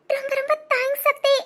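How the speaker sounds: thanking him through tears, voice cracking; choked-up crying gratitude; tearful thanks. Acted. Wife thanking her husband for bringing her parents, crying.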